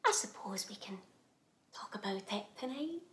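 very soft and low, almost whispered; deflated retreat. Acted. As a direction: back down quietly after no answer. Proposal unanswered, gives up.

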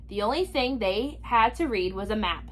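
ordinary delivery

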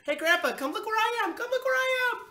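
put-on very high, loud child's call. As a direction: yell for Grandpa to come and look. Child calls grandpa from fridge top.

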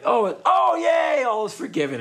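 high, loud acted-out delight; hammed-up relieved cheering; mock crowd cheer. Passengers seeing who held up the flight, mock overjoyed shout. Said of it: "So everyone's like".

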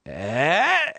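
high rising "ehh" of someone toppling; stretched comic bleat sliding upward; imitated drunk yelp. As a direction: mimic a tipsy stumble noise. Mimics drunk friend missing his step.

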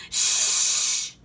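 long forceful shush. A narrator's reading. Child hushing pirates near sleeping baby, shushing. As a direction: shush them sharply.

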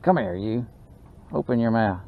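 ordinary talk

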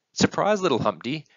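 high, loud burst on the first word; spring a happy surprise on a child; bright-eyed parent revealing a treat; excited announcement. Acted.

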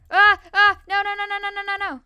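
frantic rising cries of no; real panic as the creeper blows; panicked no. Real, not acted. Player panicking at an exploding creeper, loud frantic noes.

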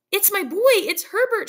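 high, loud desperate calling; straining to get to her son; frantic cry. A narrator's reading. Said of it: "she cried".

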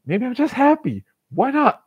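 bright, high and lifted; suddenly happy. Pretend. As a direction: toss it up playfully. Tries out joy on herself.

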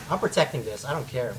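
very low, quiet resolve; low stubborn mutter. Real, not acted. Gamer protecting the node, low stubborn mutter. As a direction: mutter it deep and soft, refusing to budge. Stubbornly holding a point in game.

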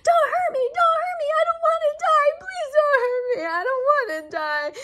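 high, loud mock screaming for mercy; hammed-up victim screaming don't hurt me; screaming plea. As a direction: squeal it out, begging for her life. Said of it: "while she screamed and said".

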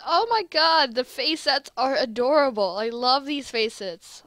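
loud, excited squee over something adorable; gushing delight. Real, not acted. Player gushes over the adorable face sets, delighted. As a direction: gush loudly, drawing out the praise.

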